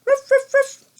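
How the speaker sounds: high, loud imitated dog barks; three short, high ruffs like a dog; dog barking. Reader showing what the dog says, high playful barking. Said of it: "And the dog says".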